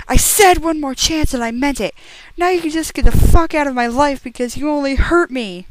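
high, loud hammed-up yell; over-the-top teen drama rage, loud and high; furious yell. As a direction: scream him out of your life, melodramatic. Heroine screams at Edward.

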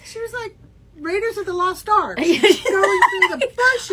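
high and loud through laughter; laughing. Real, not acted. Describing the friend crashing through bushes, laughing hard. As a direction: blurt it out while cracking up.

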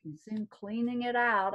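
plain reading voice

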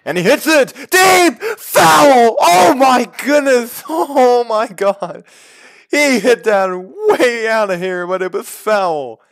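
high, loud, breathless shout; shouted excited call. Acted. Big foul ball called live.

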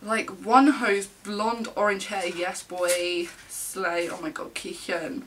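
usual speaking voice

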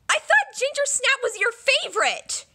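ordinary delivery

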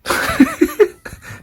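very high, short giggle squeal; high squeal laugh. Real, not acted.

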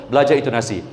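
ordinary talk

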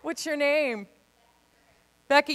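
ordinary talk, nothing marked

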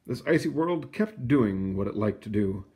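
plain reading voice

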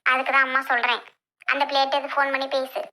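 ordinary talk, nothing marked

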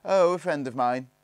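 high, loud, breezy reply; airy. A narrator's reading. Barney answers the eldest's question, airily. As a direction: toss it off airily. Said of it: "replied Barney airily".

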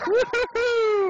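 high, long drawn-out woo-hoo; whooping. Real, not acted. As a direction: whoop all the way down. Whooping while sledding.

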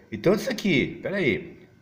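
ordinary talk

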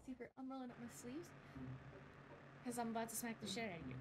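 fast, tough-guy threat; quick bravado; mock threat. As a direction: rattle off the threat like a hothead. Threatening in a character's voice.

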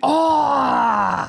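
sudden high yelp; excited cry. Real, not acted. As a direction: yelp as a fish hits. Fish strikes, he cries out.

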